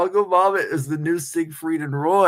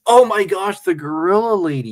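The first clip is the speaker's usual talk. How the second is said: loud, raised burst of delight; sudden loud "aha" excitement; excited recognition; 5 semitones higher, 5 dB louder than usual. Real, not acted. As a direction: exclaim as it suddenly comes to you. Suddenly recognizes the Gorilla Lady reference.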